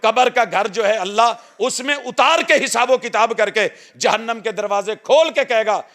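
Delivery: high, loud, urgent sermon delivery; preaching at full force. Acted. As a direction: preach it out to the whole congregation. (ਜੋਸ਼ੀਲਾ, ਉੱਚੀ ਆਵਾਜ਼ ਵਾਲਾ ਵਾਅਜ਼)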